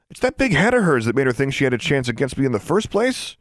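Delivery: high-pitched sneering jab; taunting. A narrator's reading. Rival keeps taunting the loser.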